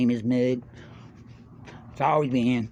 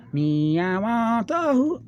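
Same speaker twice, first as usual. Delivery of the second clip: quoting a lyric; 9 semitones higher, 3 dB louder, much slower than usual. Retold or imitated.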